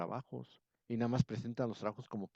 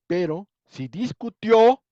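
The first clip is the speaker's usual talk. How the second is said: "discutió" pitched high and loud, slowly, on the last syllable; stress on the final O; 9 semitones higher, 14 dB louder, slower than usual. Shown as a demonstration. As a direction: land hard and high on the -tió of "discutió". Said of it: "ahí va el acento". (acento fuerte y agudo en la O final de "discutió")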